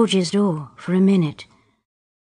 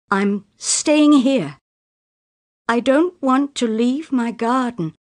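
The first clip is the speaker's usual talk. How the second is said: loud refusal; 4 semitones higher, 4 dB louder than usual. Acted. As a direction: push back hard against him. Daughter refuses father's marriage plan.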